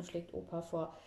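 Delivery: ordinary delivery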